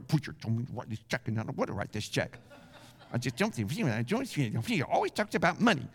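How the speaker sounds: low, fast, sulky grumble; grudging giver. Acted. Reluctant church member grumbling over the check, sulky and fast. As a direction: grumble it like a giver forced to pay up. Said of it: "that's not cheerful".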